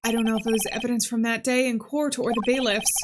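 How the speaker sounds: ordinary talk, nothing marked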